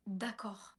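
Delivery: a hint of an angel-like child; little girl's voice. The mysterious child's voice answering her, lightly voiced. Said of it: "Une voix de, de petite fille".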